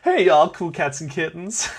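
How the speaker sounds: mock Tiger King greeting; Carol Baskin impression. Host doing Carol Baskin for quiz players.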